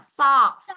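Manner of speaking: loud "Socks" with hissed S; clear S ending. Shown as a demonstration. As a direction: let the final S out on "Socks". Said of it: "Có âm S cho cô nào".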